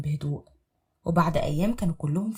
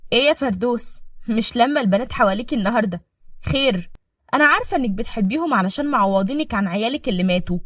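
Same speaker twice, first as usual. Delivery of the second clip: a touch higher, louder sly woman; lightly voiced scheming wife; 4 semitones higher, 7 dB louder than usual. Wife taunting the maid in the kitchen. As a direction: needle her with fake sweetness.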